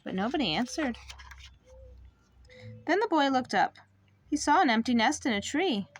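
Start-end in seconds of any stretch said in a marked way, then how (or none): none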